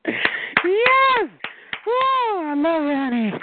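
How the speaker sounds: high-pitched delighted whoop; gleeful. Real, not acted. Relishing the rival caller's fight, gleeful whooping.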